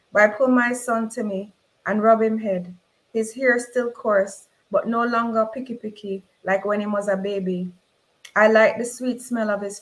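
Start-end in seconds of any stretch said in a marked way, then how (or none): none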